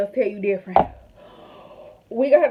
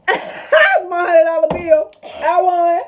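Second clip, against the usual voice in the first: loud laughing shout, very slow; victory shout; 7 semitones higher, 7 dB louder, much slower than usual. Real, not acted. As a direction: yell it out in triumph. Celebrating winning the money.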